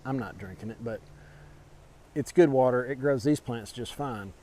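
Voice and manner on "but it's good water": normal talking voice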